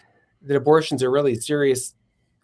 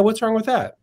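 higher-pitched retold challenge; casual challenge; 8 semitones higher than usual. Childhood friend challenges him on abortion, casual challenge. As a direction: toss out a friend's rhetorical question.

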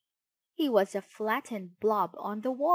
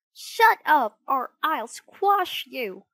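loud, high threat; annoyed puppet yelling; cried in anger; 5 semitones higher, 10 dB louder, slower than usual. A narrator's reading. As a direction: shout down a pest, drawing out the threat. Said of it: "he cried".

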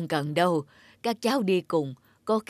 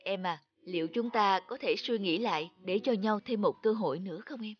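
tender plea; 7 dB quieter than usual. Acted. Husband asks wife for another chance.

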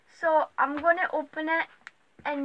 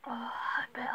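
strained whisper; 4 semitones lower, 10 dB quieter than usual. Real, not acted. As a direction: whisper through the sourness. Sour candy, whispered.

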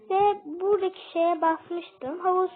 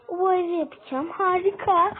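ordinary talk, nothing marked

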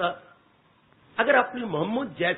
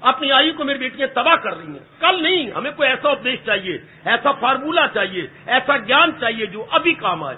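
ordinary talk, nothing marked

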